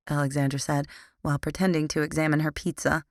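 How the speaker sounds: usual speaking voice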